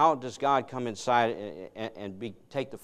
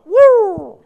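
sudden whooping yelp, much higher and louder; loud high dog yelp; 18 dB louder than usual. Retold or imitated. Preacher imitating the dog's whooping yelp at heel, loud and high. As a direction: let out a wild yelp like an excited dog. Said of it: "And the dog went".

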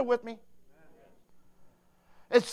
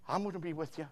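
soft, lowered voice; tender promise; 5 semitones lower, 13 dB quieter, faster than usual. Acted. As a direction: promise it quietly and gently. Voices God comforting Diane.

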